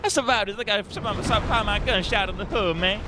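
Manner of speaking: a cartoonish high street voice.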